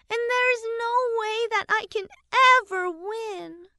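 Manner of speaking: put-on high little girl voice, rushing then trailing off; a hurt child getting the words out; small voice. A narrator's reading. Daughter telling her father about the mean girl, a small high voice. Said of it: "Haley said in a small voice".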